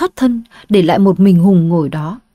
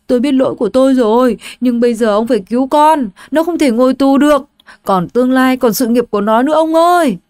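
tearful plea; 5 semitones higher, faster than usual. Acted. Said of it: "Bà Lan vừa khóc".

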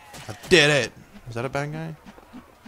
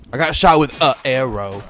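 louder, indignant repeat; annoyed; 8 dB louder than usual. Real, not acted. Gamer shot by an archer, louder indignant complaint. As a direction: complain it to the room. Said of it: "repeated louder".

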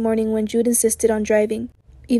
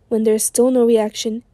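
plain reading voice

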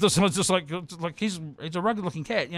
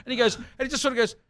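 usual speaking voice

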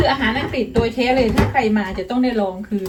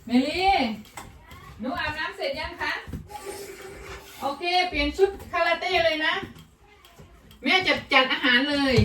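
calling to daughter; 6 semitones higher, 6 dB quieter than usual. Real, not acted. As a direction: call across to your daughter in a lifted, drawn-up pitch. Mother calls out to daughter.

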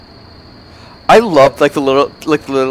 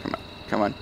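ordinary talk

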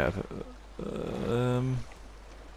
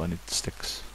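breathy whisper, much softer; 3 semitones lower, 10 dB quieter than usual. Real, not acted. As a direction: whisper it to yourself. Muttering to self while foraging.

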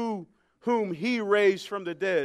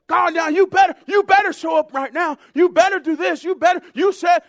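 loud, high ultimatum; demanding, threatening; 12 semitones higher, 14 dB louder than usual. Retold or imitated. Unbalanced believer threatening God, loud high imitated demand. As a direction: imitate someone making pushy demands, voice raised. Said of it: "demand and talk to God like that".

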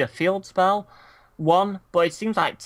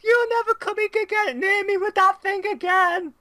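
high, loud fake indignation; mock outraged yell; 4 dB louder than usual. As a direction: shriek it in fake offense.

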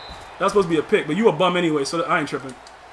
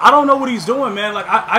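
ordinary talk, nothing marked

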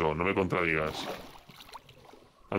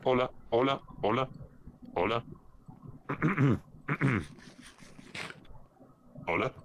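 robot voice a bit higher; new robot voice; 4 semitones higher, 4 dB quieter than usual. Acted. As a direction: test the new voice, still robotic. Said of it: "Sigues teniendo una voz de robot".

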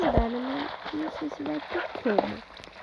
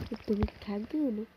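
ordinary talk, nothing marked

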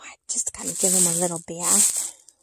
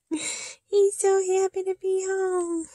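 higher, gentler coo, slow; 10 semitones higher, 4 dB louder, slower than usual. Real, not acted. Crooning to the new doll in baby talk. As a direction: croon it again more softly. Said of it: "I cannot help talking like that".

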